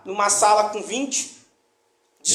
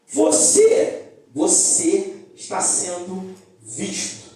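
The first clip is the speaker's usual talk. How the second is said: slow, weighty emphasis; 3 semitones lower, much slower than usual. Acted. Pastor drives home his point.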